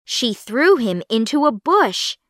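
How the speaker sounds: ordinary delivery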